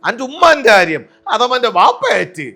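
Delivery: Mamukkoya voice in a mimicry show; Mamukkoya impression. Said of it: "അനുകരിക്കുന്നത് ... Shri Mamukkoya".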